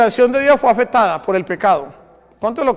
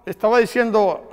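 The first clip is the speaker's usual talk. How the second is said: ordinary delivery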